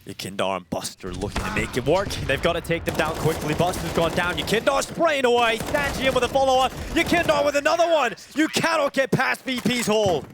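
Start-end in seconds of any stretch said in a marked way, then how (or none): 4.4-10.2 excited. Real, not acted. Caster calling a fast fight.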